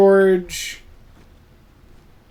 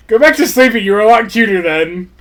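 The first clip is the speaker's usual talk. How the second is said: louder playful scold; mock scolding; 3 semitones higher, 6 dB louder than usual. Real, not acted. Teasing the pet to go back to sleep. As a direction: tell it off in jest.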